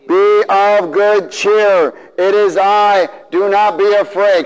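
loud, very slow proclamation; preached Jesus, loud and drawn out. Retold or imitated. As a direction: call out reassurance across the storm. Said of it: "Jesus spoke to them, saying, what?".

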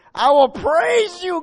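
loud, high fervent preaching; preaching at full force. Acted. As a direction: cry out the verse in praise.

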